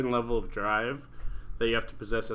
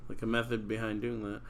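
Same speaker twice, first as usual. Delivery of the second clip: normal talking voice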